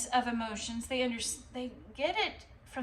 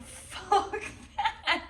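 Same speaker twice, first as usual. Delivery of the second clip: laughing defiance; 9 semitones higher than usual. Retold or imitated. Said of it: "you're like".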